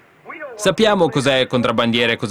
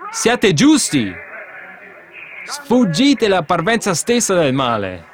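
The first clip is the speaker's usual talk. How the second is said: fervent exhortation; 5 semitones higher, slower than usual. Real, not acted. Preacher exhorting the congregation.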